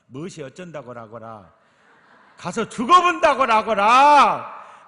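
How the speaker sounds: loud, high Jeolla dialect outburst, stretched and shouted; Jeolla dialect protest. Acted. Peter protesting to Jesus in Jeolla dialect, shouting. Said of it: "전라도 버전으로 항변을 제가 표현하면 이렇습니다".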